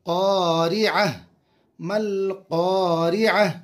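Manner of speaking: मल क़ारिअह chanted slowly; slow recitation. Shown as a demonstration. Teacher finishes the word for students, slow chant. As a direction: draw out मल क़ारिअह.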